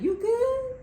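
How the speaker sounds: high, slow "you good?" in disbelief; incredulous. Retold or imitated. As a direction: stretch it out high, mocking concern.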